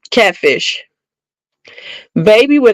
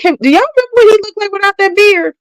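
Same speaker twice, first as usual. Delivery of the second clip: much higher, fast, disbelieving; incredulous mock voice; 11 semitones higher, faster than usual. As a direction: squeak it out like you can't believe them. Mocking the fans' praise.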